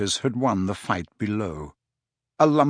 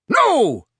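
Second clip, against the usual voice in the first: sharp high shout; 14 semitones higher, 7 dB louder than usual. A narrator's reading. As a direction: cut in with a shout. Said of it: "said sharply".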